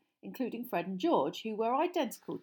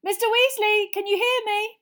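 high, loud hail; calling through boards; 14 semitones higher, 11 dB louder than usual. Acted. Harry to Mister Weasley behind the fireplace, calling. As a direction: call out to someone behind a wall. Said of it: "called through the boards".

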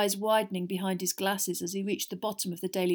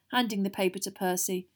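ordinary delivery, nothing marked

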